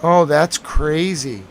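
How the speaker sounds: ordinary talk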